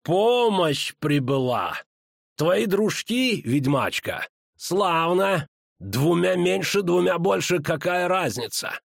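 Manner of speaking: high, drawn-out mocking villain voice; taunting villain. A narrator's reading. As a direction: taunt them slowly, stretching each word. Villain Bonhart taunts newcomers. (издевательски, врастяжку)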